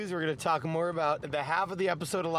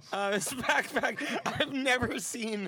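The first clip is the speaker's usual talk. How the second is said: slow, laughing through the words; laughing imitation; 6 semitones higher, slower than usual. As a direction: giggle through a goofy impression. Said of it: "This guy is like".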